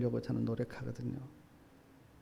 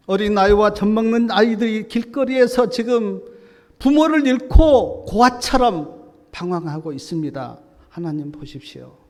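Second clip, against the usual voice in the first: pleading lament; 5 semitones higher, 9 dB louder than usual. Acted. Jeremiah's lament voiced in sermon.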